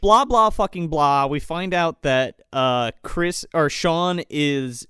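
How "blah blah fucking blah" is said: ordinary talk, nothing marked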